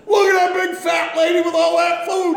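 full-out high mocking holler; yelling. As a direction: yell it across a crowded restaurant. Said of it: "and he yells".

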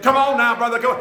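high, loud revival-leader call; hollering at the man to stand up and dance; song leader calling out. Retold or imitated. Song leader urging the man with the broken hip to stand, loud call. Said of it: "He's like".

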